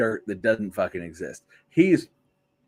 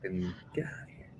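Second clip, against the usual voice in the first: fast, breathy whispered brush-off; joking whisper; 6 semitones lower, 16 dB quieter, much faster than usual. Real, not acted. Brushing off a friend in a quick whisper. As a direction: wave him off in a whisper.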